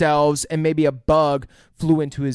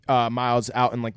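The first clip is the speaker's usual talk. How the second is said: ordinary talk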